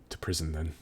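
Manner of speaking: low, soft voice with heavy pauses; grave, reluctant lieutenant. Acted. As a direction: make the arrest quietly and reluctantly. Game master voices the lieutenant.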